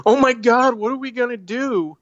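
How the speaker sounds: high-pitched squeal of mock panic, far above normal; hammed-up horror victim shrieking; panicked movie girl. Girl in the horror movie panicking, high mock squeal. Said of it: "girl's like".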